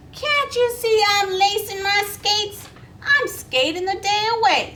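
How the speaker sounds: breezy horned toad. Acted. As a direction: brush her off, too busy skating. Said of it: "said Taffy".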